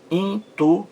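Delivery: "Intuindo" split high as in - tu - in - do; syllable by syllable. Shown as a demonstration. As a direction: break "Intuindo" into separate syllables. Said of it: "Olha a separação silábica". ("Intuindo" dito sílaba por sílaba, in - tu - in - do)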